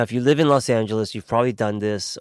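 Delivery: ordinary talk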